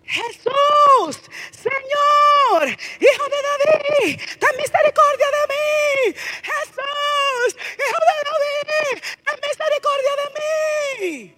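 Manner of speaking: desperate high pleading shout, close to tears; woman crying out. Acted. Canaanite woman cries out to Jesus, desperate high pleading shout. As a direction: cry out to Jesus as a desperate mother begging for mercy. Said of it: "la mujer venía gritando".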